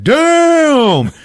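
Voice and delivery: high, loud drawn-out exclamation; hammed-up amazed yell; comic shock. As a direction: shout it way up high, astonished. Acted shock at cat eyes.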